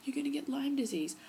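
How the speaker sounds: high, loud alarmed cousin. Retold or imitated. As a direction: play the horrified cousin. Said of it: "she's like".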